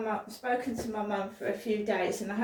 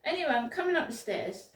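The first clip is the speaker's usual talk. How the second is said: ordinary talk, nothing marked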